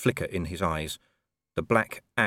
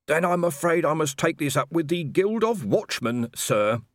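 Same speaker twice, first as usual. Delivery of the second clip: higher, polite junior officer voice; dutiful young constable; 8 semitones higher than usual. A narrator's reading. Constable Shu politely asking Colon for the wages chitty. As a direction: report earnestly to your captain.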